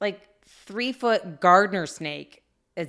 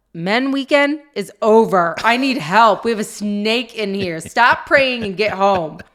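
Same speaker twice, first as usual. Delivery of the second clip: mock urgent plea; 3 semitones higher, 8 dB louder, slower than usual. Imagined call to husband.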